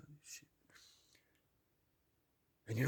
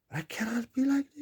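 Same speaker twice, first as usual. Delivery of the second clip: crying; 10 semitones higher, faster than usual. Acted. Ego voice breaking down.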